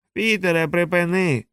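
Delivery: big brother parroting the younger brother's plea, mocking; mocking echo. A narrator's reading. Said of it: "Чудово! Виходить, ви, жучари, здатні вгадувати наші імена".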